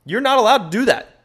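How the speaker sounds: high, mocking future boss telling you off. Imagined boss scolding the returning worker, high and mocking. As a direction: squeak it out like a snooty manager. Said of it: "they're probably gonna be like".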